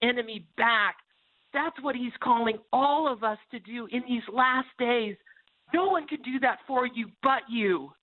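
rally the listeners to take back their lives; preaching. Acted. Preacher urges listeners to take back home and heart, fervent.

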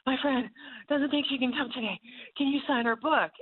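high breathless rush; breathless eager fan. Retold or imitated. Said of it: "she's out of breath".

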